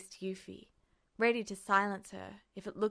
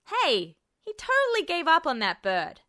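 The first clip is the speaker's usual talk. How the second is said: protesting; 9 semitones higher, 10 dB louder than usual. A narrator's reading. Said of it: "Jack protested".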